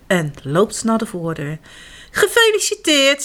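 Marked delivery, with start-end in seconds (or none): 2.1-3.2 bright congratulating call, higher; cheerful. Acted. Papa Wapper greets the man at the door, cheerful. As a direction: announce the prize with glee. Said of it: "zegt hij vrolijk".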